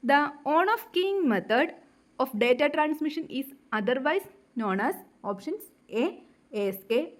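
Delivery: reads the question out very slowly, word by word; slow question reading. Acted. Teacher reading exam question aloud to students, very slow dictation.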